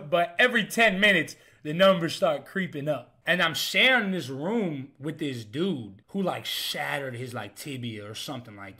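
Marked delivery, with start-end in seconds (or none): none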